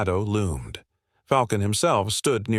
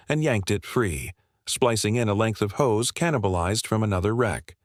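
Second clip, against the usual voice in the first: plain reading voice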